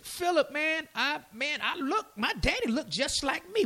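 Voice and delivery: high, casual retelling; retold casual Messiah. Preacher retells Messiah talking to Philip, casual and high-pitched. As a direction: quote it pitched well up, chatty.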